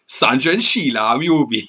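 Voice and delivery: imitating a Geordie mate; put-on Geordie accent, swinging up and down. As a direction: do your Geordie friend's teasing nickname. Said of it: "for the Geordie accent".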